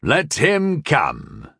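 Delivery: slow, drawn-out gruff call; guard captain calling out gruffly, stretching each word; gruff, unhurried permission. A narrator's reading.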